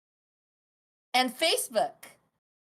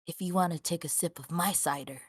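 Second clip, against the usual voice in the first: Whisper Daddy voice; 8 semitones lower than usual. Pretend. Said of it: "in the Whisper Daddy voice".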